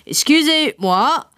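slow stiff English French; polite clumsy French; English-accented French. A narrator's reading. Father speaks French.

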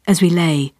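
plain reading voice

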